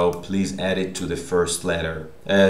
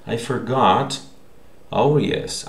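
ordinary delivery

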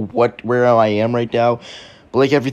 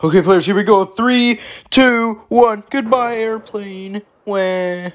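pitched-up daredevil send-off; hammed-up countdown; 10 semitones higher, slower than usual. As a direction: call it out, then draw out the farewell. Countdown to jumping out.

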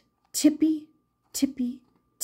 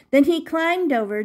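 ordinary delivery

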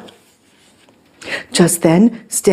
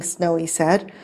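ordinary delivery, nothing marked

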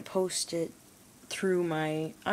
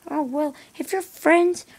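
much higher, louder lecturing parent; mocking parent; 11 semitones higher, 8 dB louder than usual. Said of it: "your parents are all like".

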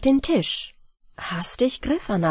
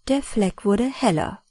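plain reading voice

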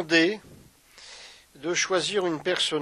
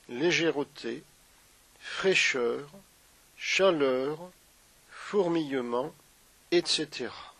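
unhurried meditation guide with long pauses; guided meditation; 2 semitones lower, 3 dB quieter than usual. Acted. Yoga teacher guiding listeners through a body scan, guided meditation.

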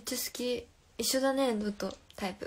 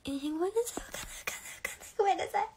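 flustered; 9 semitones higher, much faster than usual. Real, not acted. Flustered by a question she can't answer.

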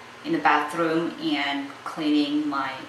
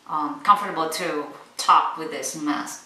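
ordinary talk

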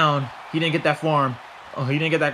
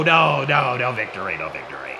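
fading disappointment; 4 semitones lower than usual. Real, not acted. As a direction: let the repeated denial deflate away. Hope of victory falls away.

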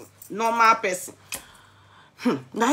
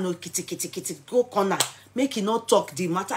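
ordinary talk, nothing marked

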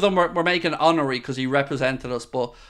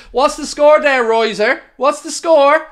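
louder, higher, pushing for the score; impatient demand; 9 semitones higher, 6 dB louder than usual. Acted.

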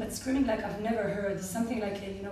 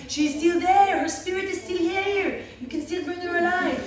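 urgent pleading; 8 semitones higher, 6 dB louder than usual. Retold or imitated. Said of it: "she's saying".

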